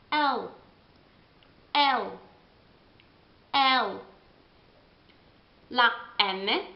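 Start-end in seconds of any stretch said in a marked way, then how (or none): 0.1-4.1 L said as el, cut short; English L. Shown as a demonstration. Teacher drilling English L. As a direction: stop L halfway, el. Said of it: "si ferma a metà".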